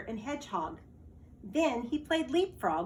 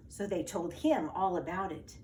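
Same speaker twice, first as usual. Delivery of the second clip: ordinary delivery, nothing marked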